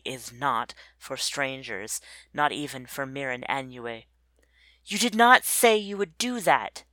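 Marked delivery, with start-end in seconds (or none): 4.8-6.8 sharp, louder, higher reproach; angry accusation. A narrator's reading. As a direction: confront them with raised voice. Angry Mirin Anue confronts master.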